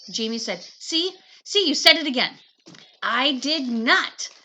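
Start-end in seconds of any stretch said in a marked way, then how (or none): 0.8-2.4 high, loud gotcha; triumphant yell. Acted. Brother crows over sister's slip, high and loud. Jamie catches her again. 3.0-4.3 slow, loud denial; stubborn denial. Acted. Sister stubbornly denies to brother, slow and loud.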